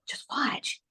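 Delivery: soft unvoiced whisper; hushed whisper. To her mother by the bed, a soft whisper. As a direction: breathe it so nobody hears.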